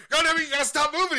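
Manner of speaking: loud, high, rapid scolding; hammed-up fury at a squirming baby; mock exasperated yell. Friend trying to get a diaper on a doll, mock furious. Acting buddy fighting with the doll.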